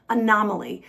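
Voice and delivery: ordinary talk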